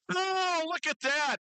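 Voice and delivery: high-pitched, admiring exclamation; drawn-out high "oh" of delight; excited reaction. Real, not acted. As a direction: marvel at the lift, pitch way up. Announcer reacts to a lift.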